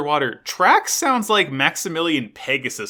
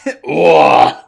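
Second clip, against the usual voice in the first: loud groan; 11 dB louder than usual. Retold or imitated. Echoing the groan.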